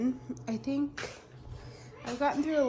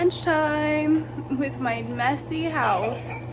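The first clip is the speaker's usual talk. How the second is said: drawn out; 5 dB louder, much slower than usual. Acted. Announcing vlog segment.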